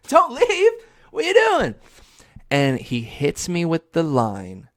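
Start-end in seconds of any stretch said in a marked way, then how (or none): 0.1-1.7 very high, loud hammed-up begging; mock desperate plea. Kid begging Steve not to leave, mock desperate. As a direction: cry out in mock panic. Kid begs TV host to stay.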